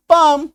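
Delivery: "पाम" as one syllable without L; silent L. Shown as a demonstration. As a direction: say "पाम" firmly, long A into M. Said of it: "सही उच्चारण निकलेगा". ("पाम" में ल silent)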